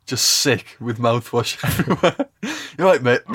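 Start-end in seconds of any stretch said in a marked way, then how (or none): 2.4-3.2 high mock concern: you all right, mate; mock concerned bloke. Friend mimics the guy who asked if he was okay, high mock voice. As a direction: ham up the stranger checking on him. Mocking the guy's question.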